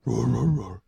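loud string of bear roars. Acted. GM's bear roaring its answer to the player. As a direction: roar on and on like the bear. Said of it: "You gotta talk in a bear voice".

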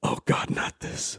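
slow, breathy whispered dismay; mock dread. Acting out listeners dreading the episode.

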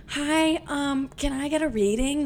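acting out a timid, nervous request. Nervous client asking a psychic for a reading, nervous and high. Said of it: "You could tell I was nervous".

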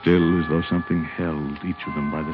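gentle storybook reading; story narration. Acted. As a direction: read it like a bedtime story. Reads a story aloud.

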